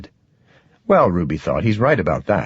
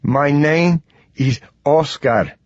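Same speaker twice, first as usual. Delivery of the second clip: put-on slow, formal South American accent, slightly raised; thick South American accent; 6 semitones higher, slower than usual. A narrator's reading. Frightened man introducing himself to strangers, formal South American accent. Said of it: "in a thick South American accent".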